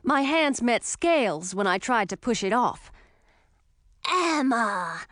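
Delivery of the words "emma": put-on light, boyish young dragon greeting his friend; a lightly voiced eager young dragon. A narrator's reading. Young dragon finds Emma in the dark, eager boyish voice. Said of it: "said the voice of an adolescent dragon".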